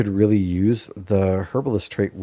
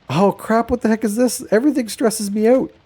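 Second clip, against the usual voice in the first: high-pitched mock freak-out; high, put-on voice of someone overwhelmed; mock panic; 12 semitones higher, 4 dB louder than usual. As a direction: squeal it in comic, stressed-out dismay. Acting out a stressed-out reaction.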